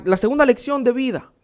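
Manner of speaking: normal talking voice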